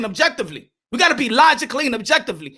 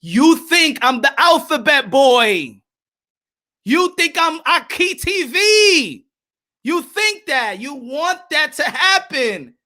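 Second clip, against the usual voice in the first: slow, deliberate, pointed retort to the chat; slow emphatic retort; 3 semitones higher, slower than usual. Real, not acted. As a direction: spell it out slowly to the doubters. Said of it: "y'all want to see a tantrum".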